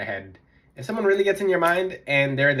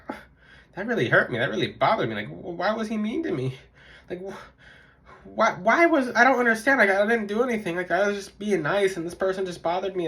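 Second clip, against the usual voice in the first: higher-pitched, wounded complaining; mock hurt; 6 semitones higher than usual. YouTuber voicing someone upset by a rude stranger, mock hurt. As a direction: play someone upset and baffled by rudeness.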